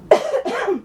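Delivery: sudden real cough; a dry cough fit. Real, not acted. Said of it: "Excuse me".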